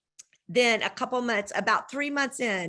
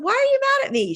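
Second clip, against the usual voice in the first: much higher and louder, stunned "Why are you mad at me?"; shocked question; 6 dB louder, faster than usual. Retold or imitated. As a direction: jump way up in pitch, disbelieving. Retold question to her friend.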